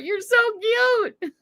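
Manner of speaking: high, slow, tearful coo; cooing at calves. Pretend. Cooing to the calves she can't bear to pet, high tearful coo. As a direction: melt over the baby cows, voice catching.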